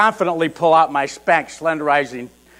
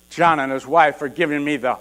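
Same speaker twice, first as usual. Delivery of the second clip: ordinary delivery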